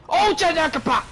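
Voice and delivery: high, loud excited cry; excited shriek. Real, not acted. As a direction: blurt it out in a sudden squeal. Exclaiming in game.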